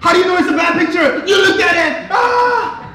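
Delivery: put-on high, fast squabbling kids' shout; hammed-up kids bickering; sons shouting. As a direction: mimic the boys yelling at each other. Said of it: "my sons are like".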